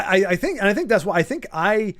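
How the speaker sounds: ordinary delivery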